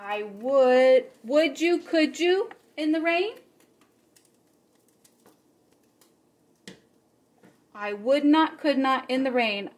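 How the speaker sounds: lively read-aloud with bouncy rhymes; story reading for children. A narrator's reading. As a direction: read the rhymes to the kids with fun. Reads Green Eggs and Ham aloud.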